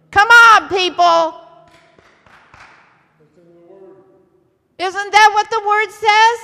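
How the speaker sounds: loud, rousing exhortation; rally the room with a big, loud voice; preaching at full force. Real, not acted. Preacher urges the congregation into the storm, full-force preaching.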